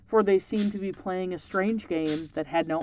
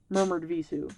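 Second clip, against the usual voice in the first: usual speaking voice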